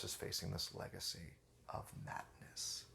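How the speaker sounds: very soft, low, breath-only hush; dramatic whisper. Real, not acted. Reviewer closing on the episode's title, dramatic whisper. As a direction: drop to an ominous whisper to close.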